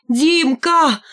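high, drawn-out call for help; frightened high-pitched cry. A narrator's reading. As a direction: cry the name out in alarm. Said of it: "закричала я".

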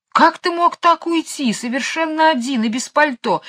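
высоко и медленно, укоризненно; отчитывает любимца; упрёк. Acted. Хозяйка отчитывает собаку. (мисс Ларк корит Эдуарда, высоким голосом)

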